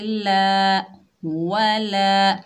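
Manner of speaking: वला very slow, the la held one alif long; one-alif stretch. Shown as a demonstration. Teacher models वला stretched one alif for students. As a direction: hold the la of वला. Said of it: "एक अलिफ की मिकदार खींच कर".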